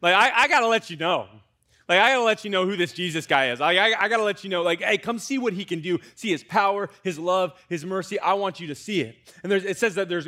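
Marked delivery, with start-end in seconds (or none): 0.0-9.1 louder, higher, urgent and fast; an apostle bursting to share, louder and higher; eager Philip. Retold or imitated. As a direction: burst out like a man who can't keep the news in. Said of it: "Philip's like".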